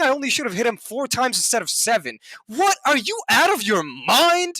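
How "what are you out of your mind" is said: high, stretched-out disbelief; incredulous outrage. Retold or imitated. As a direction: demand it as if the advice is insane. Outraged reaction to the lady's advice.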